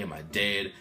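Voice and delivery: usual speaking voice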